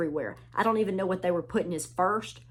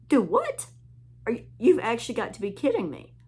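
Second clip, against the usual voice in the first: higher, louder disbelief, then rushing on; you can't be serious, said fast; incredulous; 4 semitones higher, 3 dB louder than usual. Retold or imitated. Mocking fans' hype with high disbelief. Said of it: "I'm like".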